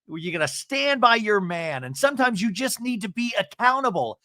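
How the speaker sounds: hammed-up scolding castmate; mock scolding. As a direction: scold her hard. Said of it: "And Emily's like".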